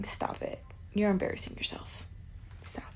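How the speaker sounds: hushed, disgusted whisper; cringing whisper. Acted. Telling an apologising man to stop, disgusted whisper. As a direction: whisper it in secondhand embarrassment.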